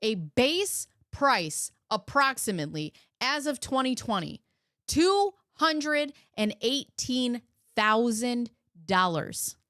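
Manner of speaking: slow, deliberate price announcement; pointed emphasis. Real, not acted. Commentator exposing what their dream car cost, slow and pointed. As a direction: spell out the figure slowly, phrase by phrase.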